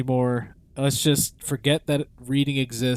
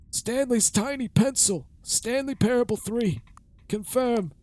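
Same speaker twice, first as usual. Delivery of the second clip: put-on high creaky old man; old man voice, pitched high; 9 semitones higher than usual. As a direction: play a rickety old man. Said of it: "I have to do the old man voice".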